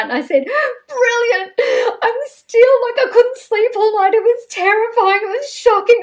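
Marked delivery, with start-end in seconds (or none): 0.9-1.5 a much higher, louder, half-crying "Brilliant."; incredulous echo. Retold or imitated. She throws her PR friend's praise back at him, incredulous. 2.0-6.0 much higher and louder, breathless with remembered shock; reliving the horror. Retold or imitated. Telling the host how the fall kept her awake, high distressed.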